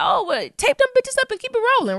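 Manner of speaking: high, quick, incredulous mock reaction; retelling his reaction to broken glasses, high and incredulous; mock disbelief. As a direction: squeak it out like you can't believe it. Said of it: "I was like".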